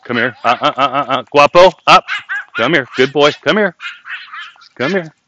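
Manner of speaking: high, loud calls to a dog; calling a dog. Real, not acted. Calling and praising his dog.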